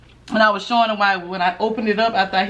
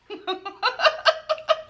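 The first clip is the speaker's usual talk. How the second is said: high-pitched giggle. Real, not acted. Laughing at her own tease.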